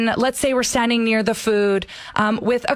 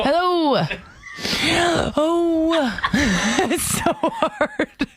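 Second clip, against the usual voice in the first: strained words on the in-breath; speaking on inhale; 7 semitones higher, much slower than usual. Acted. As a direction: talk while sniffing in. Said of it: "speaking while breathing in through their nose".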